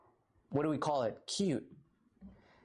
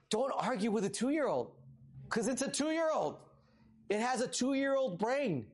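exasperated; 7 semitones higher than usual. Real, not acted. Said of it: "pastor telling son about toddler".

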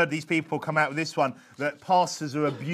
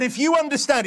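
high, raised preaching voice; yelled appeal; 7 semitones higher, 4 dB louder than usual. Acted. Preacher voicing Jesus yelling at listeners, high and raised. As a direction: call it out urgently to the crowd. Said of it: "He yelled at them".

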